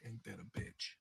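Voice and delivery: breathy whispered laugh through the words. Real, not acted. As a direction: whisper it while cracking up. Laughing punchline to the story.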